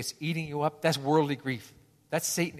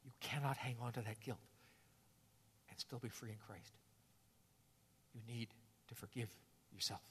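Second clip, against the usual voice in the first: Jesus urging us to forgive ourselves, hushed; hushed comfort; 4 semitones lower, 19 dB quieter than usual. Retold or imitated. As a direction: speak it near a whisper.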